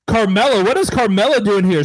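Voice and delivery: startled commentator outburst; shocked surprise. Acted. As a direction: sell the shock of a surprise run-in. Surprise interference during match.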